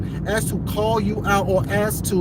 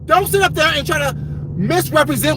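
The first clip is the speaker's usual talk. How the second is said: louder and higher, hard and forceful; loud and gruff; 5 semitones higher than usual. Real, not acted. Answering Olivia the Oracle in her own style: loud, gruff. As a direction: show her you can match her volume. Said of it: "I can also get loud and gruff".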